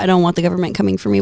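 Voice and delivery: normal talking voice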